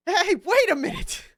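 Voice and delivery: loud high cartoonish getaway; flustered Larry. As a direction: try to dodge and flee in a hurry. Voices Larry character.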